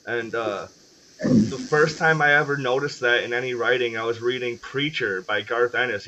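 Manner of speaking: ordinary delivery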